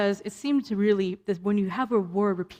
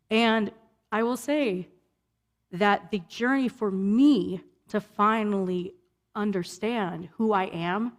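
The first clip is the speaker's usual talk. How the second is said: ordinary talk, nothing marked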